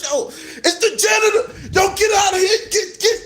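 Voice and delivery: high, urgent warning cries; mock panic. Friends spotting the janitor in the hall, panicked. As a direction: squeal in hammed-up terror to clear out.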